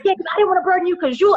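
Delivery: high, fast mimicked friend; imitating her friend. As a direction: rattle it off in a high put-on friend voice. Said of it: "She's like".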